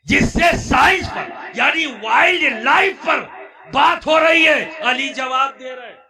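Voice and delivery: high, very loud shouted sermon; full-force preaching. Real, not acted. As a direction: proclaim it in awe at a full shout. (بلند آواز میں پرجوش خطابت)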